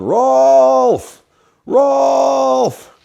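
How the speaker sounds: loud, high yell calling across the woods; dad yelling a name. Retold or imitated. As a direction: holler his name like a father calling his son home. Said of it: "I could hear him yelling".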